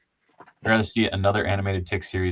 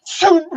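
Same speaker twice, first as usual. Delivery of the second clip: loud, much higher shooting sound; whooshing launch; 10 dB louder than usual. Retold or imitated. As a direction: mimic a body flying off. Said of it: "you see him go".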